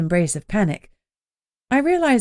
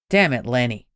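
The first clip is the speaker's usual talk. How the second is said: groused; 6 semitones lower than usual. Acted. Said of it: "my boss groused".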